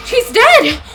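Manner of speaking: high, loud cry; acted outburst. Student saying their friend is gone, distressed outburst.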